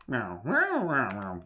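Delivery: playful cat meows. Pretend. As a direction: meow like a cat.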